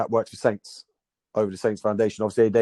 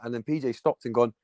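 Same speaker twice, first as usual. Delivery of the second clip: normal talking voice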